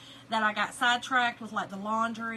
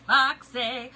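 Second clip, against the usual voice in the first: "foxy" said louder, with a falling tune; 9 dB louder than usual. Shown as a demonstration. Trying out the paint name "foxy", said louder and punched. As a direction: punch the second "foxy" out with force. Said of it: "I think that's supposed to be".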